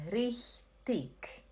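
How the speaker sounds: richtig ending in a crisp k; hard k ending. Shown as a demonstration. Teacher showing Lacerda the second option, hard k ending. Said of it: "ou você fala".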